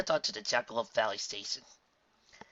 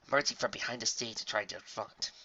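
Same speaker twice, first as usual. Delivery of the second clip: ordinary delivery, nothing marked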